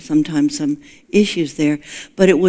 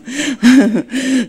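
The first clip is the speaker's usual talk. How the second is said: laugh; 7 semitones higher than usual. Real, not acted. Laughs at the invisible sign.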